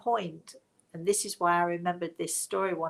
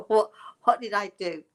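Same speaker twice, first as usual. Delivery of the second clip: mock alarm; 2 semitones higher, 8 dB louder than usual. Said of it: "you're thinking".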